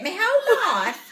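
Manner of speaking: high, loud put-on English accent; mimicked English accent. Telling the lady about the Texas Panhandle, loud English accent. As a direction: declare it grandly in a fake English accent. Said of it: "we're talking like this the whole time".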